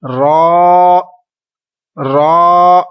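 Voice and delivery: रॉ said loud and thick; teacher modelling the right रॉ; heavy full रॉ. Shown as a demonstration. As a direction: give रॉ a full heavy sound. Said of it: "इसको आप क्या पढ़ेंगे".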